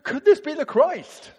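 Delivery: bursting to tell the town; excited certainty. Shown as a demonstration. Woman telling her townspeople, excited loud certainty. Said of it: "She's going".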